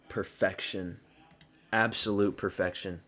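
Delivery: low, hushed admiration; soft, contented verdict; quiet satisfaction. Real, not acted. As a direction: savor it softly and low. Savoring the result.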